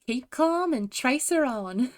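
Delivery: hammed-up British heroine line; fake British accent. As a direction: stretch it out in playful British. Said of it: "do a fake British accent".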